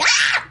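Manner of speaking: short high-pitched yelp; playful startled "ah!"; mock scared scream. Acting out being scared on set.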